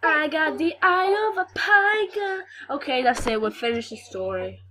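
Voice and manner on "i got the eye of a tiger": mock boastful snake.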